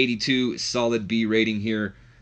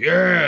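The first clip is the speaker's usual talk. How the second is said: loud, high weightlifter's yell; mock lifting roar, raised pitch; imitated scream; 9 semitones higher, 10 dB louder than usual. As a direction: let out an acted straining scream. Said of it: "he screams".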